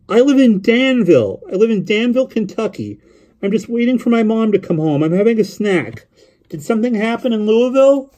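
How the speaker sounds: put-on high boy's voice, running on eagerly; young boy rambling on the phone; chattering lonely boy. A narrator's reading.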